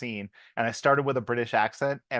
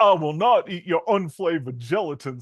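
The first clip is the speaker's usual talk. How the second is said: a hint of Keanu's bad Jacksonville accent; 3 semitones higher, 3 dB louder than usual. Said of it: "I'm not even gonna try".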